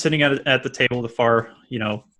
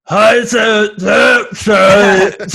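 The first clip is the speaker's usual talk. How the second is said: put-on loud, high drunken babble; 9 semitones higher, 9 dB louder, much slower than usual. Game master acts out the player starting to speak, loud garbled drunken babble. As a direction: blurt garbled syllables at full volume. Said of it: "and you go".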